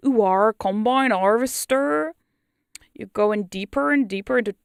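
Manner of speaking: hammed-up rural English farmer accent; hometown UK accent. How they would have sounded as a UK hypnotist, broad country English accent. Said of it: "I would have sounded like".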